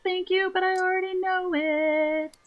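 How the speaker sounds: high cute creature voice. Acted. Voicing a tiny sweet creature for the game, high and squeaky. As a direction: squeak it out like a tiny magic pet.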